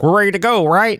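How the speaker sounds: high eager companion voice; chirpy eager ally; eager Drider voice. Retold or imitated.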